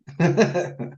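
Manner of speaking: bright burst of laughter. Real, not acted. Laughing at scam story.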